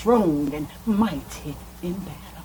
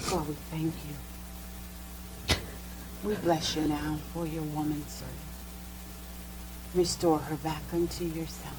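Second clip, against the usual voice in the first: hushed prayer; 3 semitones lower than usual. Real, not acted. Minister praying over his sister, hushed and reverent.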